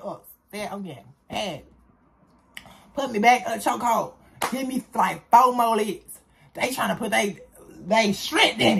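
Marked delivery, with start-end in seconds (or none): none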